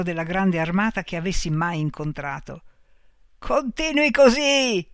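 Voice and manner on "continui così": a loud, slow, high cry of glee; soldier shouting. A narrator's reading. Old soldier cheers the gambler with a loud, slow shout. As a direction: call it out slowly, urging him on. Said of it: "gridava il soldato".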